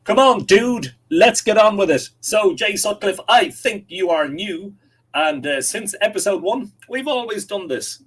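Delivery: high, loud pushy voice; hammed-up hurry-up demand; mock impatience. Host reading an impatient comment, mock pushy and loud.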